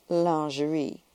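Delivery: lingerie in showy French; French pronunciation, drawn out for effect; exaggerated French accent. A narrator's reading. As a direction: lay the French accent on thick. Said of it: "pronounced the word with an exaggerated French accent".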